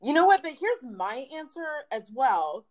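ordinary talk, nothing marked

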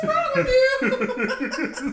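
high, loud, slow laugh-through. Retold or imitated. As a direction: drawl it out laughing. Echoing the old lady laughing.

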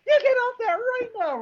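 very high scolding mother impression; mock yelling mom. Mom ordering her kid off the game, high mock yelling. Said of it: "started yelling at me".

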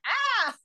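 a high, startled yelp. Retold or imitated. Guest retelling hearing her name yelled, high startled yelp. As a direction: jump as if your name was just shouted.

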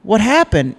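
high, loud mock bewilderment; bewildered child. Storyteller as his five-year-old self questioning the umpire, high bewildered cry. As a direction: replay a baffled kid's protest. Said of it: "And I go".

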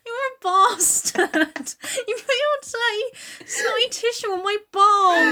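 high, slow comic whine; hammed-up wronged animal; aggrieved horse. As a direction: wail the complaint in drawn-out dismay. Plays the horse, aggrieved and whining.

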